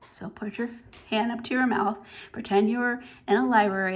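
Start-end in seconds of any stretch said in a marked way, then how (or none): none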